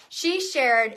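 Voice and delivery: usual speaking voice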